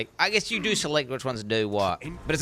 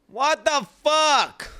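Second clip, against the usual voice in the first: much higher, slow and louder; stunned outburst; 13 semitones higher, 5 dB louder, much slower than usual. Real, not acted. Streamer reacting to being compared to Leopold Stotch, baffled outburst. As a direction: blurt it out in disbelief.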